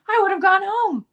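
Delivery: imitating an indignant colleague, squeaking it out high; hammed-up high indignant cry; indignant protest.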